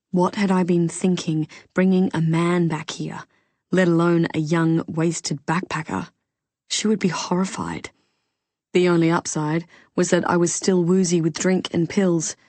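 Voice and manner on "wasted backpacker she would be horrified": usual speaking voice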